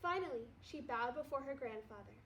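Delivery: ordinary delivery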